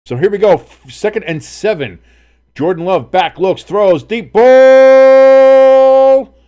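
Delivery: high, loud game call building to a long cry; excited play-by-play. Real, not acted. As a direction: build the call, stretch the last word high. Live play-by-play of a deep pass.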